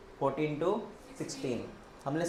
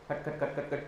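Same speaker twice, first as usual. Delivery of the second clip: imitated mechanical clacking; 4 dB quieter than usual. Shown as a demonstration. Teacher miming the Terminator's change, quiet staccato clacking. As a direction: tick off the clacks like robot parts switching. Imitates robot transformation noise.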